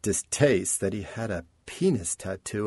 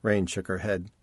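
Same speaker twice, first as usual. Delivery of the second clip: ordinary delivery, nothing marked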